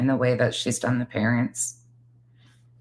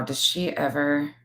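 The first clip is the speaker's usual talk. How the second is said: ordinary talk, nothing marked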